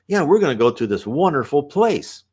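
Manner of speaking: high, loud, eager promise; excited child. Retold or imitated. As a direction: lightly act an excited child leading a friend away. Child spirit promises wonderful place.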